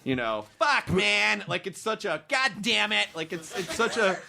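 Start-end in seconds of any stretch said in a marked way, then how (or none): 0.6-1.4 harsh American. Shown as a demonstration. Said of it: "the resulting sound is a very kind of harsh". 2.3-3.1 harsh American. Shown as a demonstration. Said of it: "the resulting sound is a very kind of harsh".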